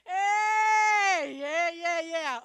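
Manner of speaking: loud high cheering yeahs; rally the crowd with joy; excited shout. Real, not acted. Congregation cheers, she shouts.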